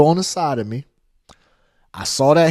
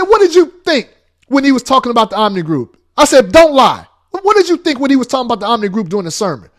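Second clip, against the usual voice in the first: louder, faster grilling; loud interrogation; 6 semitones higher, 5 dB louder, faster than usual. Retold or imitated. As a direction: press her hard, fast and loud. Interrogating wife, quarrel.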